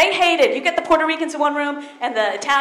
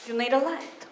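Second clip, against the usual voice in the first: put-on Italian grandma accent; slower than usual. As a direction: scold like an old Italian nonna. Grandmother voiced in a story.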